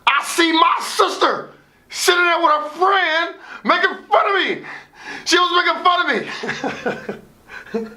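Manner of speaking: laughing. Real, not acted. Sister mocking him, laughing.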